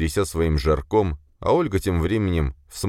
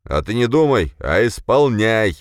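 louder, higher order, stretched last word; sharp command; 6 semitones higher, 6 dB louder than usual. A narrator's reading. Boss orders subordinate to execute, sharp. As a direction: order her sharply to obey. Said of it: "резко бросил Беспалов".

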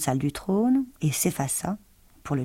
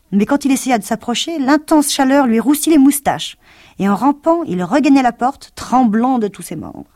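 higher, louder urgent telling; rising alarm; 7 semitones higher, 7 dB louder than usual. A narrator's reading. Narrator telling the lion's scorched retreat, urgent. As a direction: build the fright as he crawls away.

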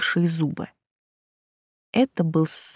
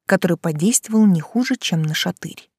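ordinary delivery

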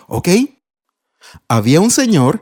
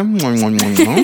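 kisses; 4 semitones lower, slower than usual. Real, not acted. Father kissing daughter.

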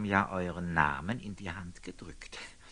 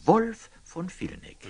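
much higher and louder, ringing out; triumphant loud naming; 12 semitones higher, 9 dB louder than usual. Acted. Friar reveals the knight's name to Nathan, ringing out. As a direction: land the name like a revelation.

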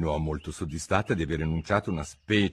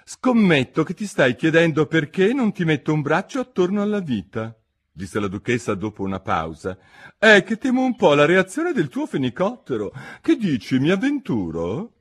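higher, louder, pompous duchess; 8 semitones higher, 5 dB louder than usual. A narrator's reading. Duchess lectures Alice, pompous and higher. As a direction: preach a moral she cannot recall.

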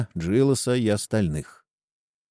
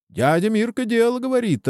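high-pitched narrated cry; mild crowd yell; 13 semitones higher than usual. A narrator's reading. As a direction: call it out high, holding back the volume. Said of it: "орут другие". (сдержанный выкрик высоким голосом)

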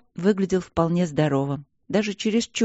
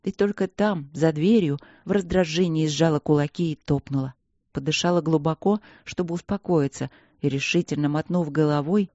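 ordinary delivery, nothing marked

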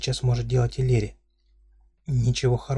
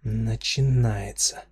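slow, drawled weary word; drawn out; much slower than usual. Acted. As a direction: stretch it out, fed up. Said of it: "протянул я".

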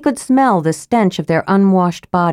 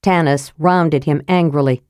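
usual speaking voice

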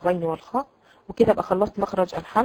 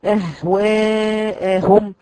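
very slow, drawn-out recitation; careful tajweed reading of أهواهم; recited example; much slower than usual. Shown as a demonstration. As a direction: let each sound ring slowly. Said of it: "جمعت ما بين الهمز والهاء".

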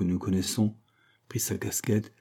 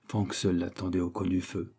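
ordinary delivery

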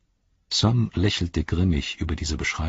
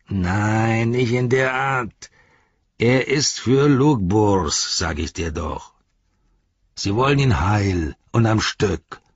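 drawn-out, higher orc objection; exasperated orc; 3 semitones higher, 4 dB louder, slower than usual. Acted. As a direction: insist slowly, as to a slow pupil. Voices exasperated Shagrat correcting Gorbak.

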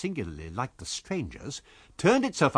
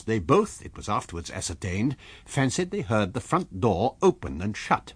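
ordinary delivery

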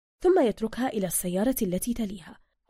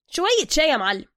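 higher, louder eager praise; butter up the boss; flattering Soka; 6 semitones higher, 6 dB louder than usual. Acted. Soka flattering master Zergina, higher and louder.

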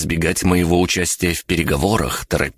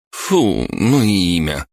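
a pitched-up exclamation of disgust; 3 semitones higher, slower than usual. A narrator's reading. Girl reacts to hearing Шор's name, disgusted. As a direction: recoil in distaste from the name. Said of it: "Точно услышав какую-то мерзость".

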